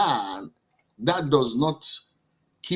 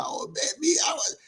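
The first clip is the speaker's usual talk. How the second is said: high excited yelp of a greeting; mock overjoyed squeal at the door; 13 semitones higher, 4 dB quieter than usual. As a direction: burst out gushing at her. Husband acting out greeting wife.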